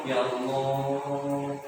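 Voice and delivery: a slow, soft, drawn-out plea with a hint of tears; tearful ya Allah. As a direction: stretch it out softly as if the heart is about to cry. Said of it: "Langsung nangis". (rintihan pelan hampir menangis)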